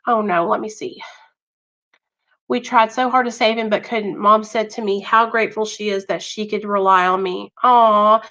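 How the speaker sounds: ordinary talk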